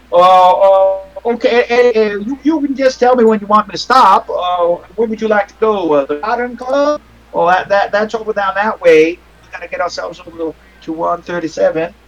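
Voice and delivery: put-on dithering older man's voice, stumbling over his words; older cab driver. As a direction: play a hesitant, obliging old cabbie.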